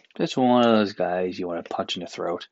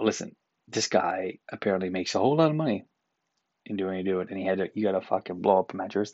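ordinary talk

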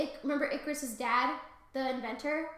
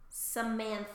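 ordinary delivery

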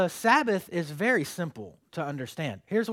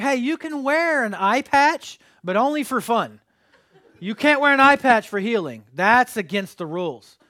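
loud, higher, sarcastic mock rule; 5 semitones higher, 9 dB louder than usual. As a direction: ham up the absurd rule with a laugh. Mocking absurd Pharisee rule, laughing.

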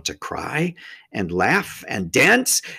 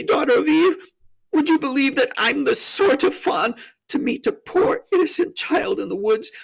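high, wailing self-accusation; hammed-up distraught high voice; tearful confession; 13 semitones higher, 4 dB louder than usual. A narrator's reading. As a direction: pour out guilt as a weeping faun. Tumnus confesses in tears.

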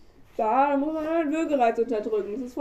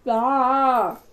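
usual speaking voice

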